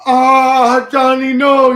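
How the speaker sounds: put-on high, loud wailing old lady; hammed-up panicked falsetto cry; screaming old lady. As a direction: shriek in mock horror. Acts old lady pushed down steps.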